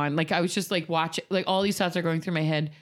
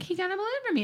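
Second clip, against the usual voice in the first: put-on child voice; 4 dB quieter, much faster than usual. Daughter excited about clown's balloon.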